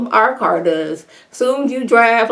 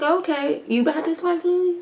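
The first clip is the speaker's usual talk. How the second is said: mock disbelief; 10 semitones higher, 6 dB quieter than usual. Mocking Lily's tough talk.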